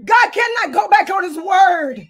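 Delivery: a preacher rising to full force; shouting preacher. Acted. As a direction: shout the promise out high. Escalating preaching.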